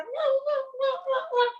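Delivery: high squeaky puppy meows; puppy yelp. Retold or imitated. Comic imitating a puppy's first yelps, high squeak. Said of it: "and then".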